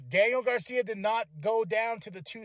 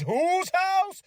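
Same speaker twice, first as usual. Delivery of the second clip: higher, slow, drawn-out crowd call; hype-man call; 11 semitones higher, 6 dB louder than usual. Retold or imitated. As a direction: stretch it out like working a crowd. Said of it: "Nana says".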